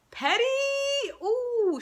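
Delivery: high-pitched, gossipy disbelief; mock-scandalised. Viewer reacting to her spite, high scandalised squeal. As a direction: let it soar up, relishing the pettiness.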